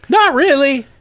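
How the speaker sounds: high-pitched housewife reply; Gamorrean woman. Acted. Woman asked about her ex, high-pitched. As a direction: answer in a high character voice. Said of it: "A middle-aged Gamorrean woman".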